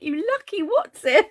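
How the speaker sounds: high teasing voice of a jealous friend; mock envious viewer. Crafter quoting a jealous viewer, high and teasing. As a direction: tease yourself in a friend's voice.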